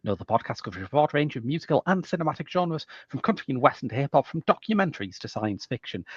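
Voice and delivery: rapid auctioneer-style patter; fast talking. Real, not acted. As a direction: rattle it off quickly. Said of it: "impressively on brand for how quickly you talked".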